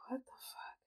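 whispered disbelief; shocked whisper. Retold or imitated. Head in hands at the video, shocked whisper. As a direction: breathe it out, unvoiced and stunned. Stunned, grabbing her head.

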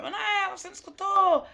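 high-pitched imitation of a scolding dad; scolding father. Guest imitating her father when she misheard, scolding father. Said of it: "E aí meu pai".

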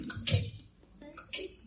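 quiet imitated frog chirp, fading away; a gentle imitation of the Puerto Rican coquí frog; soft coquí frog call. Shown as a demonstration. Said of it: "¿Qué suena así?".

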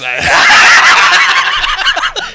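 high, loud burst of laughter; shrieking laugh. Real, not acted. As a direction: howl with laughter. Big laugh.

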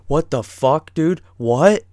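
loud, bewildered outburst; imitated freak-out. Tripping friend losing his bearings, loud imitated freak-out. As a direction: burst out like a panicking friend. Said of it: "starts freaking out".

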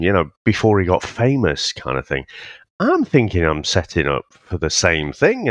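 normal talking voice

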